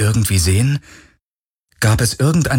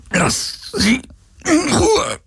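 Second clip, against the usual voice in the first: strained plea; much slower than usual. Acted. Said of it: "brachte Galen hervor".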